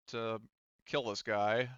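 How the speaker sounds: normal talking voice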